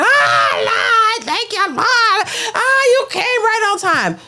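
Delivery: high hammed-up cry of thanks to the Lord; mock screaming praise. Church lady mocked for screaming thanks over a check, high wail. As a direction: wail it like a fake overjoyed churchgoer. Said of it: "falling down to the floor screaming".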